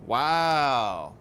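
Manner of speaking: drawn-out loud wow; amazed exclamation; surprised wow. Real, not acted. Reader reacts.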